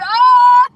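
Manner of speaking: high, loud mock scream; imitated scream. Storyteller repeating the woman's cry for people below, screaming. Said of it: "She starts screaming".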